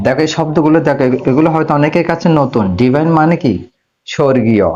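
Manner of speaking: ordinary delivery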